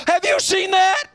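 high, loud alarmed shout; mock alarm. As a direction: blurt it out like a panicked parent. Acting alarmed adult at kid.

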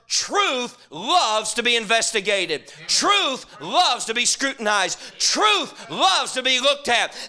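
each line slow and weighty, building; measured preaching build; slow emphatic refrain. Real, not acted. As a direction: drive the truth home slowly.

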